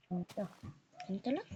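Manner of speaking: usual speaking voice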